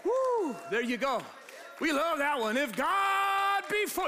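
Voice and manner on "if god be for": high, slow, drawn-out preacher's cry. Shown as a demonstration. Preacher showing the church how to read it, drawn out high. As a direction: stretch it out like a preacher in full flight. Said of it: "you gotta say it like a preacher".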